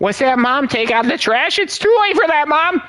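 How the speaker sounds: put-on whiny high kid yelling at mom; mock kid voice. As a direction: play a bratty teen shouting back.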